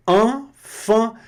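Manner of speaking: high "enfant", both vowels nasal. Shown as a demonstration. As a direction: say "enfant" with the same nasal twice. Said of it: "é a mesma pronúncia". (enfant nasal)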